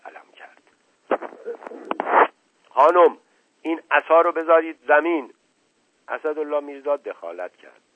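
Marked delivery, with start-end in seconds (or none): 2.7-5.3 loud, raised-pitch order; angry command. A narrator's reading. Uncle stopping woman with cane, angry command. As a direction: confront her and take charge. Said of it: "با قیافهٔ عصبانی".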